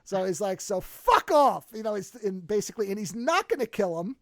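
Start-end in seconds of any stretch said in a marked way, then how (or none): 0.6-1.7 loud, slow, high fuck off; mock angry dismissal. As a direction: drag it out, loud and final. Laszlo telling the rival off.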